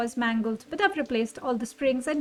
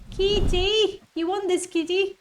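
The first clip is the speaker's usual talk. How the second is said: higher, louder call to a cat; calling the kitty; 6 semitones higher, 6 dB louder than usual. Real, not acted. Player calling a cat to take the item, higher and louder. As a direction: sing out to coax the kitty over.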